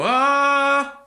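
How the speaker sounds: loud high rasped ah; fry scream. Shown as a demonstration. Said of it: "and then just, like, go".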